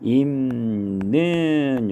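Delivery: 입는 said slowly as 임는; stretched teaching demo of 입는 as [임는]; nasalized pronunciation. Shown as a demonstration. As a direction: hold the m in 입는, "im-neun". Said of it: "요거는 발음이".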